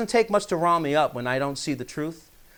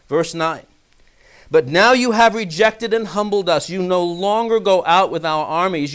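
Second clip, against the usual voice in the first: measured, solemn Bible reading; scripture reading; 3 semitones higher than usual. Acted. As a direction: read the psalm out to the congregation. Reads the psalm aloud.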